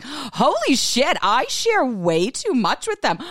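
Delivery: high, slow hammed-up horror; mock scandalized shock. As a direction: play up being appalled at yourself. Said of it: "clutching at my pretend pearls".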